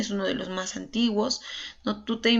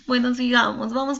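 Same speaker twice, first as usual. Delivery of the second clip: words through a real laugh, louder; 3 semitones higher, 5 dB louder than usual. Real, not acted. Teacher recovering from a nervous slip, laughing. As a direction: laugh it off and push on. Laughs at her own nerves.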